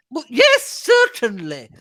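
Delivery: high, slow gushing society lady; gushing old lady. Acted. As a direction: coo it like a doting wife. Narrator voices Mrs Inglethorpe.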